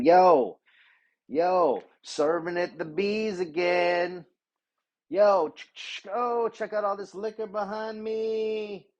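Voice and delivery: high, drawn-out influencer voice; mock a bartender filming herself for Instagram. Mocking bartender posting to Instagram.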